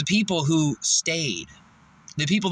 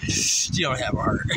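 said through a breathy laugh. Real, not acted. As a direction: giggle the words out. Laughing punchline.

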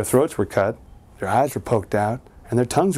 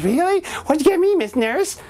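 put-on very high eager child; very high eager kid. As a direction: ask the nurse excitedly. Boy asks the nurse eagerly.

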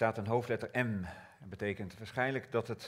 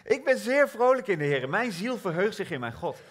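much higher and louder; joyful exclamation; 9 semitones higher, 5 dB louder than usual. Acted. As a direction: shout your joy. Joyful declaration again.

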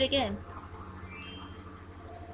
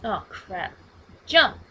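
ordinary delivery

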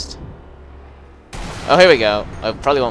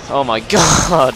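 happy excitement; 4 semitones higher, 9 dB louder than usual. Real, not acted. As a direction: exclaim it in delighted triumph. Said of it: "I'm so happy about my killing".

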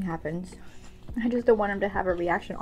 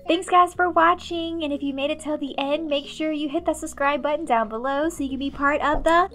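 upbeat vlog outro; 7 semitones higher, 5 dB louder than usual. Acted. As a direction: wrap up the video like a host.

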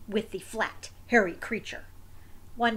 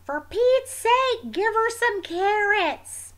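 higher, louder, slow exasperated call; fed-up voice from the next room, slow and raised; exasperated exclamation; 10 semitones higher, 8 dB louder, slower than usual. Retold or imitated. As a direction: neighbor fed up, calling out to give the wombat carrots. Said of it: "kinda hear in the background".

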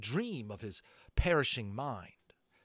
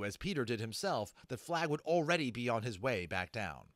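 ordinary delivery, nothing marked

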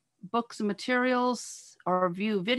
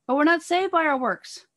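higher, louder protest from objectors; voiced objection; 6 semitones higher, 5 dB louder than usual. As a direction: push back, voice raised in pitch and volume. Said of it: "there'll be people who will say".

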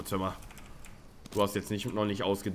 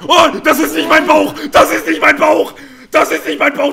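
screaming in panic, much higher and louder; panicked shout; 13 semitones higher, 16 dB louder than usual. Real, not acted. Gamer screaming at friend as jaguar attacks, panicked shout. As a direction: yell in terror as the jaguar pounces.

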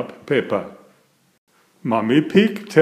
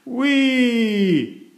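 long high sliding whee; joyful whee; 11 semitones higher than usual. A narrator's reading. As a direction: ride the sound down the hill. Sliding down the hill.

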